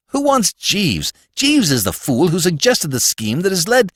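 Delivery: Corky objecting to Bertie bringing Jeeves, indignant; indignant protest. Acted.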